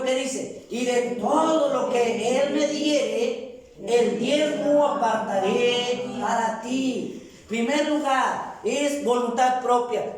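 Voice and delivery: high, slow, drawn-out proclamation; preaching at full force. Real, not acted. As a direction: proclaim the scripture, stretching the key words. Preacher reading scripture at full force.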